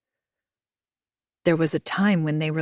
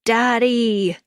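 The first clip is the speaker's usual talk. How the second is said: high loud cry for daddy; a child's wail, lightly voiced; 5 semitones higher, 5 dB louder than usual. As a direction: call out like a hurt little boy. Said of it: "wailed from the top of his lungs".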